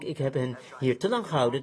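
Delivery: ordinary talk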